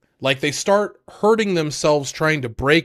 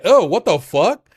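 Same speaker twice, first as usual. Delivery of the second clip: mock shocked outburst; 5 semitones higher, 8 dB louder than usual. Engine reacting to no socks.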